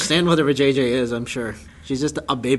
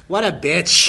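mock snide aside; slower than usual. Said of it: "he's like".

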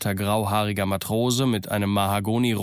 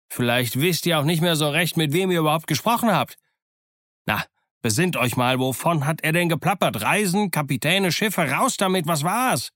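higher, pressing interrogation; exasperated, raised-pitch questioning; impatient grilling; 6 semitones higher than usual. A narrator's reading. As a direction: press him for an answer, pitch climbing. Silver grills Morgan impatiently.

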